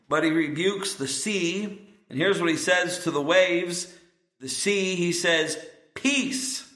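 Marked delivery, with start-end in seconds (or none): 5.9-6.7 high, drawn-out word of command; commanding the sea. Acted. Jesus rebuking the wind and the waves, high drawn-out command. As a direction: speak peace over the storm.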